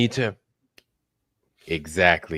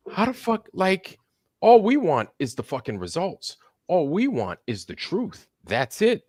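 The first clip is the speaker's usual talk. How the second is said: high, indignant disbelief; exasperated outburst; 9 semitones higher than usual. Real, not acted. Venting to co-host about critics, exasperated and high. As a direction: pitch jumps up in frustration.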